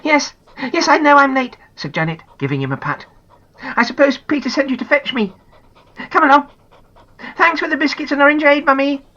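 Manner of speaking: put-on cheerful girl in a hurry; high girlish voice; young girl. Janet talks to dog and mum.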